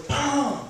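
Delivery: sudden high-pitched "bam"; explosive spoken slam, as if hitting a table; imitated table bang. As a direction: voice the bang abruptly. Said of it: "I knock on the table".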